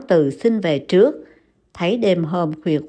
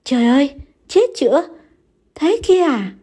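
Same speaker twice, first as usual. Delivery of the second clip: horrified cry; 7 semitones higher, faster than usual. A narrator's reading. Wife cries out in horror at her husband's blindness. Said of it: "Liên kinh hoàng la lên".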